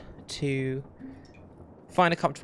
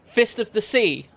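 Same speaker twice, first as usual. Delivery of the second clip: higher, louder declared challenge; bold challenge; 7 semitones higher, 6 dB louder than usual. Acted. Young fighter challenging the half elf in the tavern, loud and bold. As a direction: call him out like a battle cry. Hero challenges Graggins, pointing.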